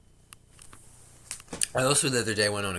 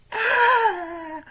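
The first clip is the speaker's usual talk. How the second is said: mock scream; 7 dB louder than usual.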